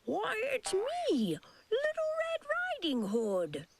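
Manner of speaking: sugary put-on little girl voice; sweetest voice. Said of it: "said the wolf in his sweetest voice".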